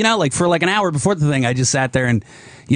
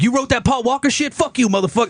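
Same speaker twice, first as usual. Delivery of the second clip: mock angry confrontation; 10 semitones higher than usual. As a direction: imitate a manager getting in someone's face, pitched up. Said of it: "got in his face and was like".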